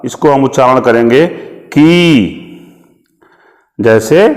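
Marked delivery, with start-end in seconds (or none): 1.7-2.4 की said with a long ii after k; syllable demo. Shown as a demonstration. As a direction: stretch the vowel in की for the long matra. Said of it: "बड़ी की मात्रा अगर मैंने लगा दिया तो".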